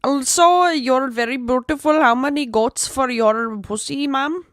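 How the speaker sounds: put-on loud hammy Indian accent; ludicrous Indian accent. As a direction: overdo a fake Indian accent. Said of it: "do an accent that's, like, ludicrous".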